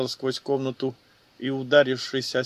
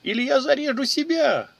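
much higher, shrill-edged panic scream; cornered woman shrieking at the soldier; hysterical scream; 11 semitones higher, 3 dB louder than usual. A narrator's reading. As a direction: scream it in terror, voice cracking up high. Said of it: "истерично заорала женщина".

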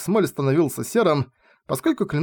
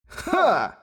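high, sudden triumphant bark of a laugh; spring the trap with a gloating shout; villain's triumphant "Ha"; 11 semitones higher than usual. Acted. Demon leaps back into the fight.

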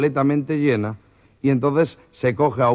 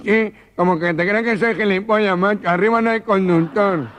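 higher, louder indignant mock nasal; indignant retort; 8 semitones higher, 4 dB louder than usual. The gangoso tells the inspector off, higher and indignant. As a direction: scoff at him indignantly. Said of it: "Y dicen".

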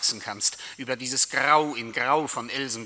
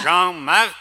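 loud call; 4 semitones higher, 10 dB louder than usual. Acted. Death calls out to the husband, loud and rising.

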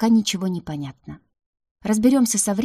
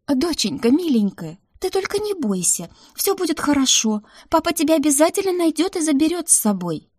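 much higher, pleading mother's voice; anxious motherly reassurance; 9 semitones higher, 3 dB louder than usual. A narrator's reading. Mother calling to her unseen daughter, anxiously comforting. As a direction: reassure urgently in a high voice.

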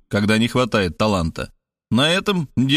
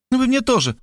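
serene retort; 13 semitones higher, faster than usual. A narrator's reading. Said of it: "мгновенно приобретая безмятежность".

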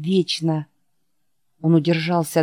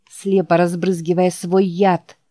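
ordinary delivery, nothing marked

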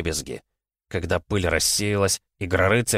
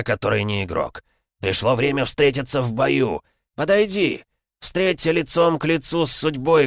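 zombie king voice; 5 semitones higher than usual. Acted. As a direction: menace like a gloating monster. (голос короля зомби)

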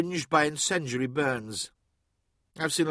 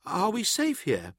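small, higher voice, seeking reassurance; frightened artificer; fearful; 7 semitones higher than usual. A narrator's reading. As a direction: ask it timidly, pitched up. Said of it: "glanced around the industrial sump fearfully".

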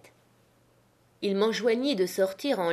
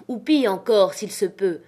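plain reading voice

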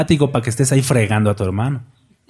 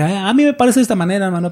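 fast, higher mock of a smug brother; mild parody of an opinionated brother; mocking a know-it-all; 4 semitones higher, much faster than usual. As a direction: lightly imitate a self-righteous churchgoer.

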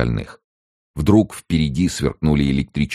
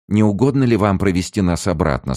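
higher, sharp demand; sharp question; 7 semitones higher, 4 dB louder than usual. A narrator's reading. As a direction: demand it sharply under a polite wording. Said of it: "спросила она резко".

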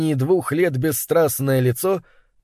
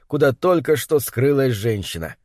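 plain reading voice

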